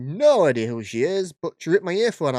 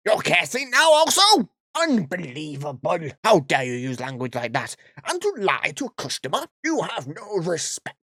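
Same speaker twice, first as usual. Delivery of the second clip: hammed-up scandalised customer; mock outrage. Karen scolding the shopper for cursing, mock outraged. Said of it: "I can't keep this voice up".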